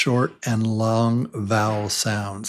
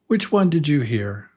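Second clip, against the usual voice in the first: ordinary talk, nothing marked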